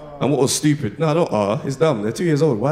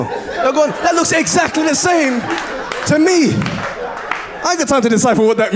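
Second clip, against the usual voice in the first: much higher and louder, fed-up yell; higher, louder exasperated punchline; exasperated shout; 13 semitones higher, 4 dB louder than usual. Real, not acted. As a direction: throw the line out in comic outrage. Exasperated punchline about guys' faces.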